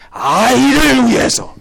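hammed-up Korean drama accent; imitated drama elder's accent, swelling high. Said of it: "I use that accent".

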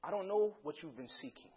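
ordinary delivery

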